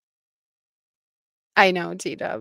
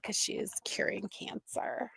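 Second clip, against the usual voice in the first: sarcastic whispered punchline, much softer; 2 semitones higher, 12 dB quieter than usual. Real, not acted. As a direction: drop to a snide whisper.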